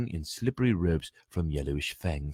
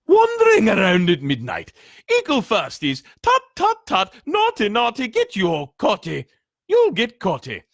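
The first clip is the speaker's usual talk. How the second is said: high, loud, sneering poltergeist taunt; high squeaky mischief-maker mocking first-years; 9 dB louder than usual. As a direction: tease them like a gleeful spirit. Peeves voiced in story.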